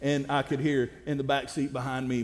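ordinary talk, nothing marked

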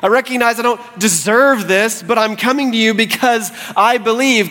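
high-pitched earnest supplication; humble plea. Acted. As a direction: pray as one who comes empty-handed. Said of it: "Comes to him in humility".

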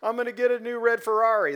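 preacher mocking a listener who misheard, high and loud; mocking misled listener. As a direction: ham up a greedy listener's voice. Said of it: "don't walk away and be like".

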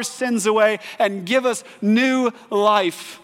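loud, high, rising sermon build; preaching at full force. Acted. Preacher fires up the congregation, loud and high.

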